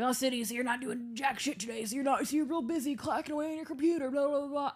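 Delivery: mocking coach. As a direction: taunt like a football coach. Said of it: "doing the usual".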